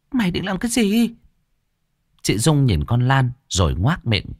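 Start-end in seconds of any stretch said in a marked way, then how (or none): 0.1-1.1 high, sharp suspicious question; suspicious frown. Acted. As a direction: demand it with a frown. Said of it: "bà Thuận nhíu mày".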